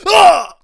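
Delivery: dark joke impression of a heart attack; mock heart-attack cry. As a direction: yelp like a man clutching his chest. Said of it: "a James Gandolfini impression".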